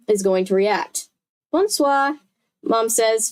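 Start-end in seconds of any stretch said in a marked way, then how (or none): 1.5-2.2 high, showy French greeting; a touch of French accent, flaunting it; showing off French. A narrator's reading. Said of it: "flexing her French".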